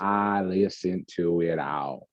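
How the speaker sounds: slow, hammed-up country drawl; bad Southern accent. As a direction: lay on a fake Southern drawl. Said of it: "worst Southern accent".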